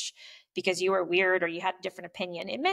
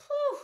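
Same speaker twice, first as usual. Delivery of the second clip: mock relieved whew. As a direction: sigh out like you dodged it. Relief after relationship blows up.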